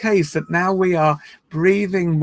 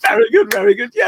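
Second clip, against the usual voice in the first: higher, louder playful chant; childlike cheer; 5 semitones higher, 5 dB louder than usual. Shown as a demonstration. Leader starts three more yays with the group, playful cheer. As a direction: build to a gleeful yay. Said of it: "three more very good, very good yays".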